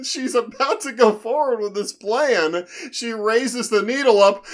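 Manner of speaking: ordinary talk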